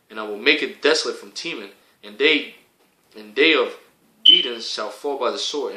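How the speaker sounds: loud, proclaiming Bible reading; booming pulpit recitation of prophecy; scripture reading. Acted. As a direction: declaim the verses like a preacher. Reads scripture aloud.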